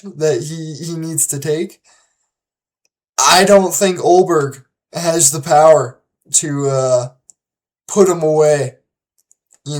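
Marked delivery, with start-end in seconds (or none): none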